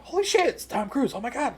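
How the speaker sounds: high, loud starstruck outburst; spazzing out. Retold or imitated. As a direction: imitate a friend freaking out over a star. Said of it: "spazzing out".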